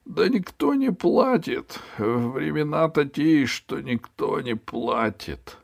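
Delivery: high, slow pleading whine; whining ferryman. Acted. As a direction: plead poverty. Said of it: "печально ответил перевозчик". (высоко, жалобно и протяжно)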